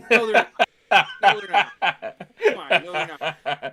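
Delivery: loud laughing protest. Real, not acted. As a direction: laugh it out, disbelieving. Laughing denial of Playmate hype.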